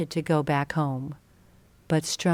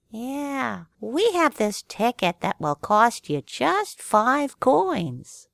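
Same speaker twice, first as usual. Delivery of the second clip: higher, sly cat pitch; lightly voiced cat; 6 semitones higher, slower than usual. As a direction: purr out the deal. Cat adds the offer.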